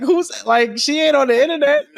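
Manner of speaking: high, quick, mock disbelief; mock incredulous voice. Retelling her reaction to grandma's question, high and quick. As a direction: squeak it out in amused disbelief. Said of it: "I'm just like".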